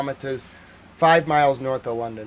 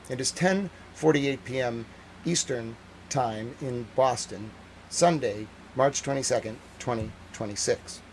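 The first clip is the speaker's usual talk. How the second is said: measured newsreader delivery, a bit softer; news read; 5 dB quieter than usual. Acted. Reporter opening his broadcast, newsreader style. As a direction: present it like a TV anchor.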